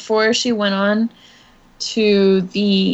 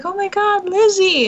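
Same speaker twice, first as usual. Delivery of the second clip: high, delighted cry of a name; excited greeting; 8 semitones higher than usual. Retold or imitated. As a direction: greet a friend with sudden joy. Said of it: "She was like".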